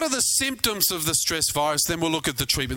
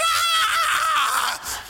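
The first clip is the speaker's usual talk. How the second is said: very high, loud mock shriek of fright with a laugh; laughing imitation of the kids screaming in terror; kids' scream; 3 dB louder than usual. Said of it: "And they went".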